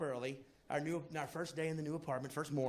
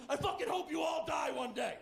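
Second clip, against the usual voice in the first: mock angry shout; 7 semitones higher than usual. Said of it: "And he's like".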